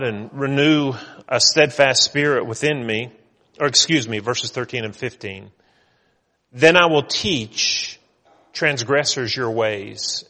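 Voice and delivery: loud, ringing reading of the psalm; preacher reading David's vow from the psalm; scripture reading. Acted. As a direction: proclaim the verses with full force. Reads the psalm aloud.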